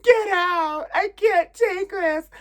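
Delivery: very high, loud, slow melodramatic outrage; imagined outraged mom. Pretend. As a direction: scream like a soap-opera mother. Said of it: "I wanted her to be just like".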